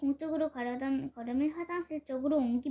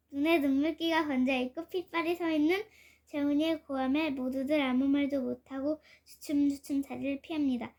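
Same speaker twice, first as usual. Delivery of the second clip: ordinary delivery, nothing marked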